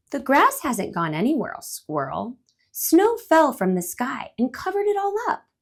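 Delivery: the bird explains snow to squirrel, gently; lightly voiced bird. Patient bird explaining.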